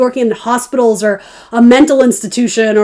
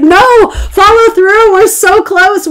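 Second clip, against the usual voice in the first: high, slow, pleading push to follow through; the universe coaxing you, drawn out and up; urging universe; 9 semitones higher, 4 dB louder, slower than usual. Pretend. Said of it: "It's like".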